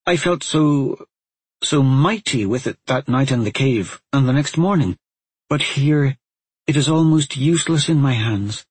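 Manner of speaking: put-on high, halting, upset girl; near tears. A narrator's reading. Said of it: "She sniffled".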